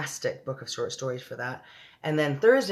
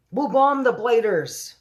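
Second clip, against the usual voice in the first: loud, slow, higher barked order; shout; 8 semitones higher, 8 dB louder, slower than usual. Acted. The Air Force head proposes bombing the giants, loud and slow. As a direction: bellow it like a commander. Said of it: "shouted the head of the Air Force".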